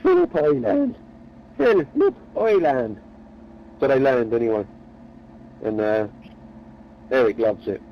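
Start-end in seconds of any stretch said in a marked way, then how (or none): 0.0-3.0 "Philip Island" high, then "Phil, Lip Island" slow; pun spelled out. Shown as a demonstration. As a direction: split "Phil, Lip" slowly, much higher. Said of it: "That's how I should have said it".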